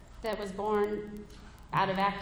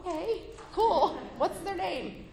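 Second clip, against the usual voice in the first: higher, slow, overly breezy; forced casual; 9 semitones higher than usual. Retold or imitated. Mother hearing her twelve-year-old's crush, acting overly casual. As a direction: play it super cool on purpose.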